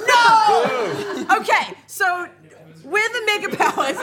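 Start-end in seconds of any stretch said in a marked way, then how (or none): 0.1-1.3 loud, high drawn-out "no"; shouted, incredulous refusal; outraged shout. Real, not acted. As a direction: cry out in protest. Outraged reaction to team switch.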